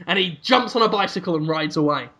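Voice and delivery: normal talking voice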